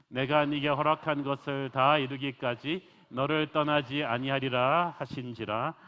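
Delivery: loud, slow proclaiming of the verses; solemn scripture reading. Acted. Preacher reads Genesis to the congregation, loud and slow. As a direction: declaim God's promise from the pulpit.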